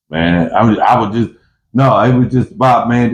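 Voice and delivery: ordinary talk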